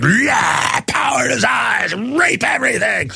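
mock triumphant villain yell; mock evil elite. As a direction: crow like a greedy tyrant. Mocking elites' inner voice.

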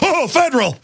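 high mock-reverent gasp; awed crowd. People awed by the name, mock reverence. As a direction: coo in fake awe. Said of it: "Everybody's like".

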